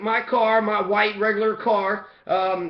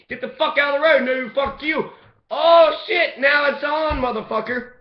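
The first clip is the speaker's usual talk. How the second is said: loud and higher, picking a fight; retold road rage shouting match; mock fight shout; 5 semitones higher, 3 dB louder than usual. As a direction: bark it out, now it's on. Acted quarrel with the cop.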